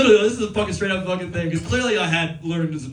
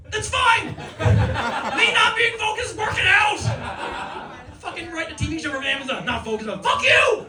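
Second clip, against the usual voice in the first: high indignant shouting; high shouting rant; comic rant; 8 semitones higher than usual. Acted. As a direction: vent at the doubter.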